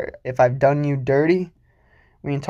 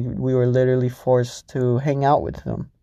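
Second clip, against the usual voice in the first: ordinary talk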